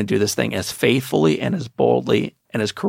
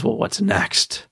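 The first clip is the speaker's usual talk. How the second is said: weary victim; 10 semitones higher, much slower than usual. Retold or imitated. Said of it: "then the question is".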